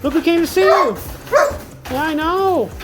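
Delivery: high sing-song dog talk. Real, not acted. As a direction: coo at the dog, pitched well up. Greeting the dog.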